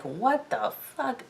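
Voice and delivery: slow, high, hushed mock shock; appalled disbelief. Catelyn watching her sister nurse the boy, mock appalled. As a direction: draw it out softly, voice lifting in disbelief.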